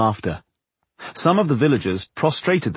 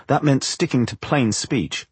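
plain reading voice

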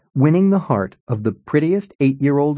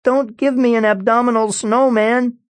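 shouting; 11 semitones higher than usual. Acted. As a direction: yell it out in panic. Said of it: "Colin shouted".

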